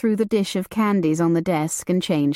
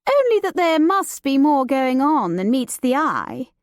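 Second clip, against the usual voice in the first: put-on higher, playful young woman's voice; light and teasing, a society lady; Judith, lively young lady; 6 semitones higher than usual. Judith teasing her cousin about the new servant, playful and high.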